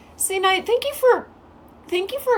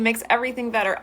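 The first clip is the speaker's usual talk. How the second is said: ordinary delivery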